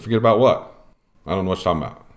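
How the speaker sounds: playing dumb. Umpire to the UIC, hiding a private talk: mock-innocent. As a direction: act like you heard nothing at all.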